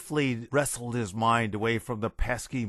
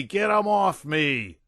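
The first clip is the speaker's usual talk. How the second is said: high, loud, slower desperate scream; screaming again; 6 semitones higher, 5 dB louder, slower than usual. A narrator's reading. General still screaming at the invisible ghosts. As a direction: drag the plea out in panic.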